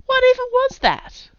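high, loud disbelieving cry; a sudden shrill burst of disbelief; shocked cry. A narrator's reading. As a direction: yelp it out, stunned by the beast. Zed shocked by the monster.

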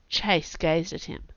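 usual speaking voice

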